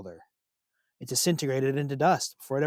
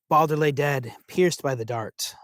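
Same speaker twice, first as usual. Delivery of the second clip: ordinary delivery, nothing marked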